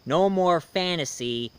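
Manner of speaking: normal talking voice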